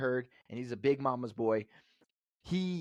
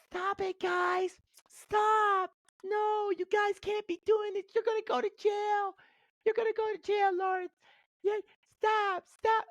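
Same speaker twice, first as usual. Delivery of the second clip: a very high, louder pleading voice of a small mom; imitating his mom; 4 dB louder than usual. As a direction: squeak out mom's frantic plea to stop. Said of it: "my mom gets in between us".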